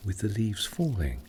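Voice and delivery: ordinary delivery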